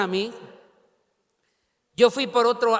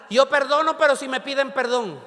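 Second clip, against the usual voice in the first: higher, louder mock protest; raised-pitch quoted objection; mimicked conditional forgiveness; 4 semitones higher, 4 dB louder than usual. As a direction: imitate someone insisting on their condition.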